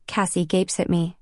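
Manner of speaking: ordinary delivery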